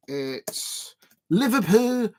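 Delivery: slow, drawn-out mock Liverpool accent; a deliberately poor Liverpool accent, dragged out; bad Liverpool accent. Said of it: "my very poor very bad Liverpool accent".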